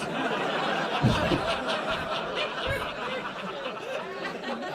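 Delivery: high airy giggle; high breathy laugh. Real, not acted. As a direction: laugh at his own joke. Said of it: "I love that joke".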